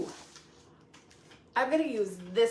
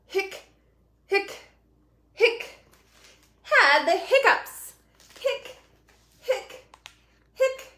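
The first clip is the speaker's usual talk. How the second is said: hiccuping; 8 semitones higher, 3 dB louder, slower than usual. Acted. Voicing skeleton's hiccups.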